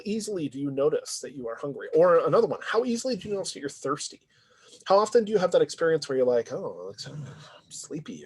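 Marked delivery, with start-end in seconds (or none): 6.5-8.3 acted drowsiness, trailing into breath; sleepy. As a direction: murmur it as if drowsy. Acting out someone feeling sleepy.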